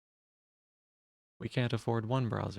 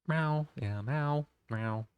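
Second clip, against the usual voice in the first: higher playful cat meows; 4 semitones higher, much slower than usual. Pretend. Streamer meowing back at chat, playful. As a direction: meow back at chat like a kitten.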